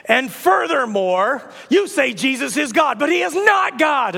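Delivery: high, emphatic declaiming, words stretched; ranting prophet. Acted. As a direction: proclaim it like an indignant prophet. Acts out prophet's escalating rant.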